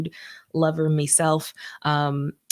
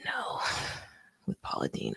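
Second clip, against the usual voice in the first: whisper; 11 dB quieter than usual. Real, not acted. Hushed ironic aside.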